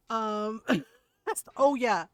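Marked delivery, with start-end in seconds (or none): none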